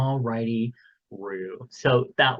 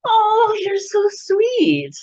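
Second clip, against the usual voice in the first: very high, slow, sarcastic; sarcastic sweet; 6 dB louder, much slower than usual. Acted. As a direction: drip fake sweetness, drawn out. Reply to Jupiter insult.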